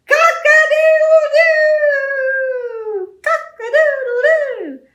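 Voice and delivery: high, slow, loud crowing; rooster crow. Acted. Storyteller crows for the rooster to wake the farmer. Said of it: "that tractor went".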